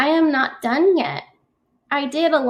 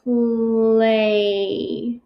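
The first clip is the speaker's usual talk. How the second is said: slow sounding out; 2 semitones lower than usual. Shown as a demonstration. Teacher sounding out Play for kids, slowly. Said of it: "the slower I say it".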